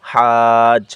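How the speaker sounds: drawn-out recitation of হাজ; long madd. Shown as a demonstration. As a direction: stretch হাজ for four counts. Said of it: "উপরে মোটা চিহ্ন".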